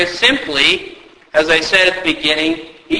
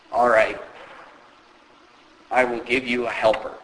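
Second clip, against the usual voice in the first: lower, softer comforting voice, fading; reassuring; 4 semitones lower, 7 dB quieter than usual. Retold or imitated. Jesus comforting Moses with a helper, soft and reassuring. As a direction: soothe him gently. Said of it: "gives greater comfort and assurance".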